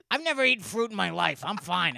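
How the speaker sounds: loud, high mock defiance; hammed-up defensive outburst; imitating defiant ma'am. Imitates ma'am defending her diet loudly.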